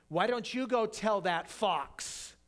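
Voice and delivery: drawn-out retort, quoted with bite; calling him out. Retold or imitated. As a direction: call out a scoundrel with deliberate force. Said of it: "Jesus just calls him out".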